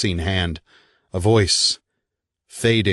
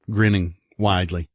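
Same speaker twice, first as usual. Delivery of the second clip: plain reading voice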